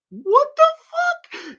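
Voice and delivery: high, loud stunned cry; shocked outburst. Retold or imitated. Viewer reacting to Esther's come-on, a shocked high cry. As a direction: blurt it out in disbelief. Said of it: "And you're like".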